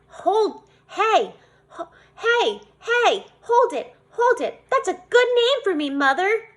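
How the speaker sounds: high, loud, bursting hey hold it; can't contain the idea; child thrilled by a new clown name; thrilled outburst. Acted.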